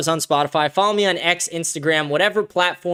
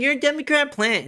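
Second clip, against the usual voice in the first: mocking quote; 10 semitones higher than usual. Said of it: "Everyone's always like".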